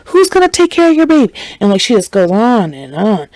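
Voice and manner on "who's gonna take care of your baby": high, fast frantic question; worked-up mom. Retold or imitated. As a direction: rattle it off high and fast in a panic. Mom lecturing about pregnancy.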